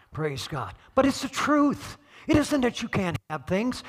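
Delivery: usual speaking voice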